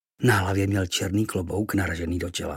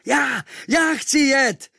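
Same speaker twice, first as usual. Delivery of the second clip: put-on much higher, slow, eager boy's shout; calling out at full lungs; 6 dB louder, much slower than usual. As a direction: shout to be picked, with all your breath. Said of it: "volal jsem z plných plic".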